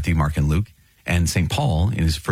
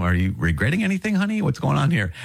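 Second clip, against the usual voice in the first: nervous bride; 8 semitones higher than usual. Retold or imitated. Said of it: "getting kind of nervous".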